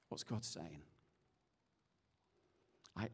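low, hushed, unhurried pastoral voice; soft prayerful ministry. Real, not acted. Preacher invites the congregation to stand and pray, hushed. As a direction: lead them gently into prayer with long pauses.

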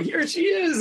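high, bright presenting voice; offering the dog. Retold or imitated. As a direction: hand the dog over cheerfully. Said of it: "kinda like offering up your dog".

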